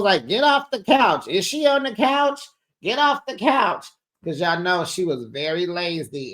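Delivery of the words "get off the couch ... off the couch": high, hammed-up nagging voice; mock bossy couch nag. Two castmates nagging a lazy friend, high mock scold. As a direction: shoo her off the couch, way up high. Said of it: "they both was like".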